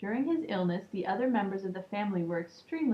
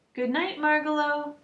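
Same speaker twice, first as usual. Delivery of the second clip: high, louder good night called after her; calling good night; 7 semitones higher, 5 dB louder than usual. A narrator's reading. Stuart in bed calling good night to the departing bird. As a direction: call it after her as she hops away. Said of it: "called Stuart".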